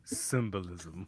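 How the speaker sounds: a pompous narrator's symbolism; mock deep voice. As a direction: drop deep and drag it out. Deadpan mock-deep comment.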